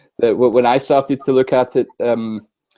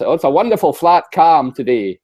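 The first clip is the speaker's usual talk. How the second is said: a lightly done West Highland Scottish lilt, a bit higher; West Highland accent; 5 semitones higher, 3 dB louder than usual. Retold or imitated. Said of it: "my best West Highland accent".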